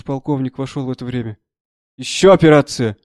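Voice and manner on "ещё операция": raised, louder outcry; angry exclamation. A narrator's reading. Colonel walking in on an amputation, exclaiming angrily. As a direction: cry out in displeasure. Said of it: "вскрикнул он, сердито смотря на лекаря".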